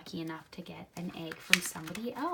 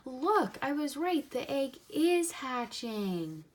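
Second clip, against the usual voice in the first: excited; 6 semitones higher than usual. Real, not acted. Reader thrilled egg hatches.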